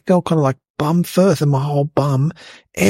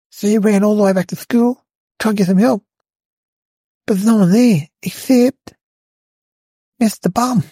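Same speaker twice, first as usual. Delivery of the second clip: ordinary talk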